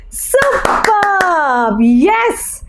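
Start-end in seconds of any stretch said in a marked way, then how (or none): none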